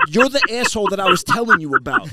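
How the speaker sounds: high, loud re-enacted yell. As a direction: shout the accusation right at him. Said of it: "I'm yelling at this kid".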